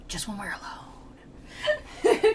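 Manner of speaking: drawn-out, slow comic whisper; mock whisper. Dante privately asks Virgil to hold hands, mock whisper. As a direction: whisper it as a jokey private request. Jokey confidential aside to Virgil.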